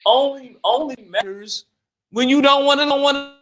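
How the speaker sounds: high, loud emphatic shout; heated shout. Real, not acted. Arguing about tone with friend, heated shout. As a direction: shout the point home. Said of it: "heated point about tone".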